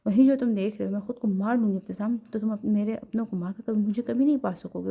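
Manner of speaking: plain reading voice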